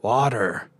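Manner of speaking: drawn-out raspy demand; lightly rasped plea for a drink; dry rasp. A narrator's reading. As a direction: croak it out, parched. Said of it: "a dry voice rasped".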